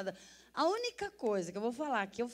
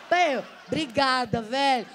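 loud, slow, over-the-top thank you; mock loud thanks; 2 semitones higher, 9 dB louder, slower than usual. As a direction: belt out the thanks slowly. Thanking robber for the cap.

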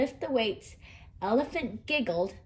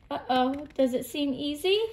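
plain reading voice

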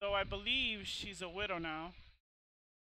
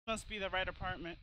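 ordinary talk, nothing marked

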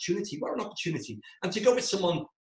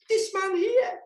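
This man here leapt up high and louder, with a changed voice; sudden high emphatic repeat; 4 dB louder, slower than usual. Shown as a demonstration. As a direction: repeat This man here much higher for comic effect. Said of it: "is this man here".

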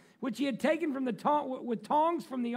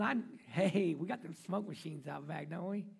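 ordinary talk, nothing marked

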